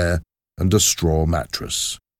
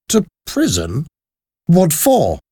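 exclaiming; 11 semitones higher than usual. A narrator's reading. Charles, arrested at the gate, exclaims in shock. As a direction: blurt it out in disbelief. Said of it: "Charles exclaimed".

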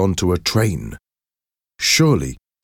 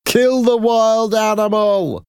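slow, much higher mob yell; bloodthirsty shout. A narrator's reading. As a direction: incite the kill. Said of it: "somebody shouted".

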